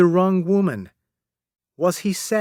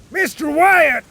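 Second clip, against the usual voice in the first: high, loud call across the deck; shouted name; 13 semitones higher, 5 dB louder than usual. A narrator's reading. Captain calls Mister Wyatt from the rowboat, shouting. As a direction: yell to get his attention. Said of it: "shouted the captain".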